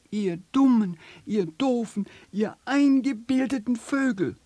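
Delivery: hoch und laut geschrien; schreiend. Acted. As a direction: schrei die Vögel wütend an. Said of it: "schreit es". (wütend schreiendes Känguru)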